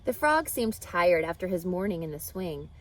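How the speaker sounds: ordinary delivery, nothing marked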